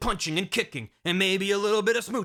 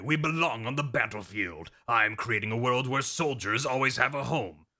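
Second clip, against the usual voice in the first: much lower, dramatic villain; low villain speech; 5 semitones lower than usual. Retold or imitated. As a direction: rumble it like a B-movie mastermind. Big Boss villain speech.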